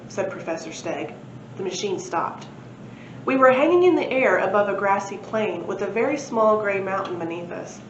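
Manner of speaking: ordinary delivery, nothing marked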